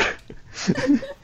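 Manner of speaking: high burst of laughter; high squealing laugh. Real, not acted.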